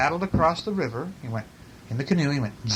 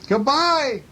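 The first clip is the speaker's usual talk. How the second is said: high called-out goodbye; calling bear; 12 semitones higher, 4 dB louder than usual. A narrator's reading. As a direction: call farewell across to the moon. Bear calls goodbye to moon.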